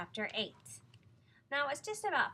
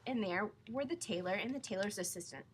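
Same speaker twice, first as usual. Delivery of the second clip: ordinary delivery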